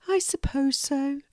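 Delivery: high, shy little squirrel; a small creature shyly conceding. A narrator's reading. Lulu the squirrel answers the hedgehog, shy and high. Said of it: "said Lulu shyly".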